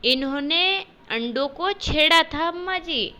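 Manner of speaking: a hint of a sulky little girl; tattling child. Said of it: "बोली".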